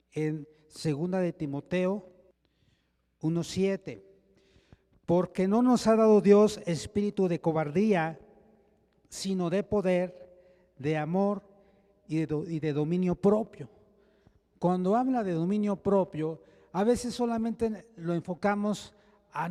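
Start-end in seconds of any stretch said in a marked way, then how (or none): 5.1-13.7 scripture reading. Acted. Preacher reads Timothy to the church, proclaiming loudly. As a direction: proclaim the verse to the congregation. Reads Bible verse aloud.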